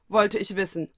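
ordinary delivery

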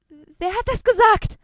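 put-on startled girl calls out at an unseen voice. Hears a voice from cake.

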